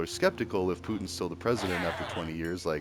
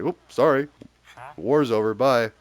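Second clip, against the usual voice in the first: breezy, careless brush-off, louder; mock flippant goodbye; 3 semitones higher, 7 dB louder than usual. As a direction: toss it off like leaving a mess behind. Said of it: "they'd be like".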